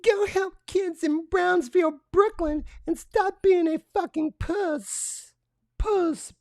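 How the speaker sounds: mocking effeminate voice. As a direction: ham up a prissy caricature while reading the tweet.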